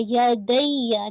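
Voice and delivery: "दैया" slow, ya held and lifted; lifted doubled ya. Shown as a demonstration. Teacher models the non-flat ya for the student. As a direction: press and lift the ya in "दैया". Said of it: "आपने flat नहीं पढ़ देना".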